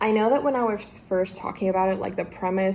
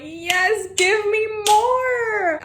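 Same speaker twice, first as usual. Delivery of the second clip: high, slow, drawn-out plea for more; eager cry; 13 semitones higher, 5 dB louder, much slower than usual. Retold or imitated. Reader voicing her inner cry at the plot, thrilled and drawn out. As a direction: beg the book for more, thrilled. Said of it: "and you're like".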